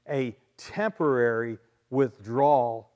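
"temporary" set apart and punched; deliberate emphasis on the word "temporary"; stress on temporary. Real, not acted. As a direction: lean hard on "temporary", pausing before "withdrawal". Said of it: "Emphasis on the word temporary".

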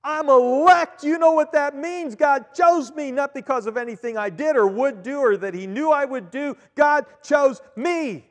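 loud, high, thrilled exclamation; a brother bursting with joy about being chosen; excited brother. Retold or imitated. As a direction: shout it out like an overjoyed believer. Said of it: "this brother was excited".

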